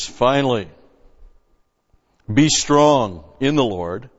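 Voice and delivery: loud, full-force preaching read; preaching scripture. Acted. Preacher reading scripture to his congregation, full force. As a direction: proclaim the verse to the congregation.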